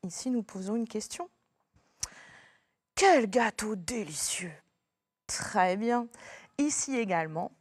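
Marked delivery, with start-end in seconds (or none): 2.9-4.5 slow, breathy rapture; delighted whisper. Shown as a demonstration. Pupil praising a delicious cake, slow hushed delight. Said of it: "On est vraiment content".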